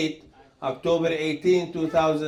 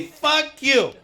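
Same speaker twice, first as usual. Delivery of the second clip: mock curse; 6 semitones higher, 4 dB louder than usual. Real, not acted.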